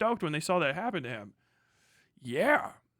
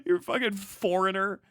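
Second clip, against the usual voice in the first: ordinary delivery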